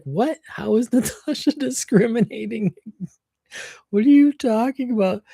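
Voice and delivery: high, confused mock coworker; a coworker's baffled disbelief; mock confusion. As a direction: play him baffled and high-pitched. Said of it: "He was so confused".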